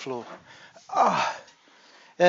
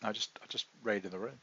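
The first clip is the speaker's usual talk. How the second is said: hurried soft refusal; 3 semitones lower, 9 dB quieter, faster than usual. Retold or imitated. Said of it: "She said".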